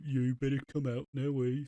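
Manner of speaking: mock Brummie, drifting towards Australian; exaggerated Brummie accent. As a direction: imitate a hammed-up Brummie accent. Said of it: "went about ten times more brummy".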